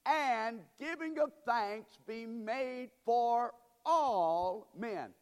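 loud, drawn-out scripture proclamation; forceful preaching. Real, not acted. Preacher urges the congregation with Paul's words, loud and slow. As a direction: press each key word hard, stretching it.

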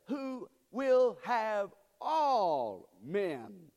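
very slow reading, "all" held long and loud; drawn-out stress on ALL. Real, not acted. Preacher reads who will be saved, "all" held long and loud. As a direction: stretch every word, swelling on "all". Preacher stretches the verse's key words.

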